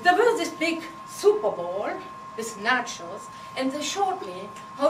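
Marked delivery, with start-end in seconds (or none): none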